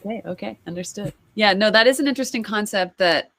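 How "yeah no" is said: ordinary delivery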